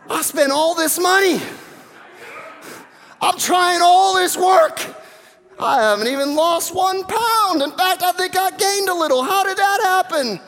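mock outrage, I did all this for nothing; frustrated disbelief. Exasperated dieter at the scale.

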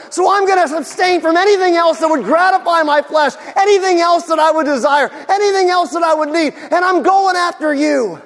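high, loud, desperate pleading; shouted prayer of repentance. Acted. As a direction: cry out to God with everything. Voicing a fasting believer praying.

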